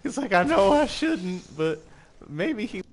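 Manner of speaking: high, loud mock voice of a hopeless wife; mock abused wife. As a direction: play the wife who keeps going back. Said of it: "It's like an abused wife".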